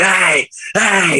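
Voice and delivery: high mock yell at the cookie sellers; calling out high like a tripping guy; retold yelling. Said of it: "he's screaming at these cookie people".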